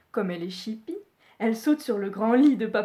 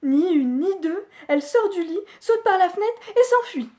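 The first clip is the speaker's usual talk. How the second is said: higher, louder, rushing narration; breathless escape; 8 semitones higher, 6 dB louder than usual. A narrator's reading. Storyteller racing through Goldilocks fleeing, loud and high. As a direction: tell the escape all in a rush.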